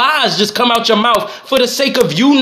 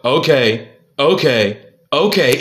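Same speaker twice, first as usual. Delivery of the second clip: dismissive okays; 7 semitones lower, slower than usual. Retold or imitated. Mimicking wife's curt replies.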